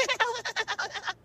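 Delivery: a shrill, goofy guffaw imitating a customer; high goofy laugh. Barber imitates customer's high laugh. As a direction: mimic the regular's distinct cackle. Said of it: "be like".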